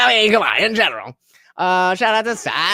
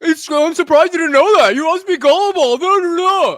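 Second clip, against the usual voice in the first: louder, higher mocking jeer; loud taunt; 6 semitones higher, 8 dB louder than usual. Taunting chatter loudly.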